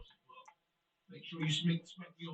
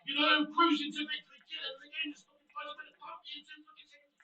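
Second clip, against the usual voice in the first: high-pitched, sarcastic, fading grumble; bitter rant; 8 semitones higher, 3 dB louder than usual. Real, not acted. Streamer complaining to chat about losing the lead, bitter sarcasm. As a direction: sneer through the rant as the energy drains.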